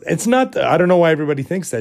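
high, rushed retold objection; flustered protest. Dreamer denying what everybody assumes, flustered protest. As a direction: sputter it out in mock exasperation.

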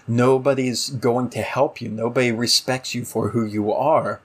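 ordinary talk

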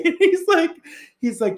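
ordinary talk

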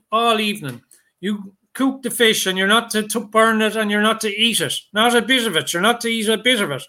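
lightly voiced stern old fisherman instructing a boy; old man's orders. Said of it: "he told the boy".

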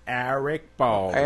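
slow, drawn-out put-on voice; mock movie quote. Quoting a line from the film, put-on voice. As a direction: stretch the name out like a movie line.

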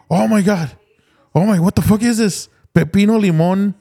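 high, amazed retelling. Retold or imitated. Retelling to a friend the drink's label, high astonished voice. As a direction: replay the shock, voice up. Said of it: "and I was like".

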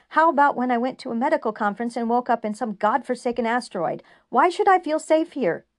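loud, high-pitched angry tirade; furious rant. A narrator's reading. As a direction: pour out every grievance, shouting. Julian's furious rant at counselor.

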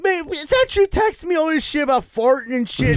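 high-pitched imitation of an exasperated caller; mock outraged questioning; incredulous confrontation. Imitating angry caller confronting him.

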